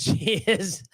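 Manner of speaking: laughing through a retold protest; drawn-out start, rising high with laughter; amused protest. Telling the airline woman about his wife, laughing.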